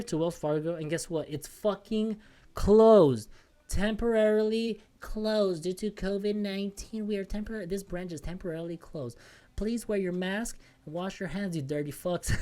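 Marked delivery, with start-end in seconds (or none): 1.3-3.3 exasperated. Real, not acted. Punchline on closed bank. 3.7-11.6 mock notice voice. Reading the branch sign aloud.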